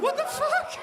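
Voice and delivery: high squeaky what the fuck; shocked squeal. Retold or imitated. Retelling the cop's order, high shocked squeal. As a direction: squeak it out in disbelief.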